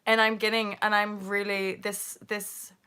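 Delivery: usual speaking voice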